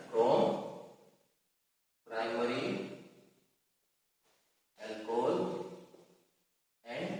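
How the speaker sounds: low, soft, word by word with long gaps; writing aloud. Real, not acted. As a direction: murmur it to yourself as you write. Writing the words on the board.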